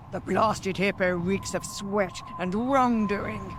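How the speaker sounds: slow, scornful hag voice; slow hag call; witch calling out. Acted. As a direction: call out to your sisters with contempt. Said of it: "she called to her sisters".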